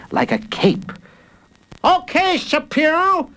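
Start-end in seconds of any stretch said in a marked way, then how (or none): 1.8-3.3 high play-fight challenge; hammed-up high battle cry; shouting. A narrator's reading. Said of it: "he shouted".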